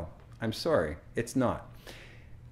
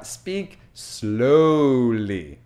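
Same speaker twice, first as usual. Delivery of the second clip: very loud, hugely stretched words; hammed-up, drawn-out order; exaggerated slowness; 7 dB louder, much slower than usual. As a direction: draw out SLOWLY as if demonstrating it. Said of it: "And the third time".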